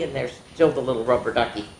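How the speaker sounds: usual speaking voice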